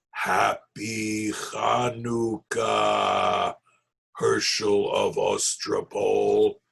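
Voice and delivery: very slow, eerie monster voice; bone-cracking goblin voice. Acted. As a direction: drag each word out like cracking bones. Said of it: "a voice that resembled the cracking of bones".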